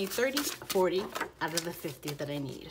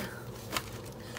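a soft breathy giggle; quiet airy giggle; giddy laugh; 9 dB quieter than usual. Real, not acted. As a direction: laugh with delight. Giddy over the money.

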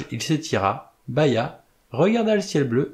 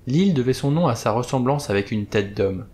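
ordinary delivery

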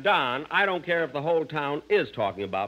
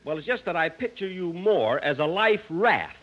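normal talking voice

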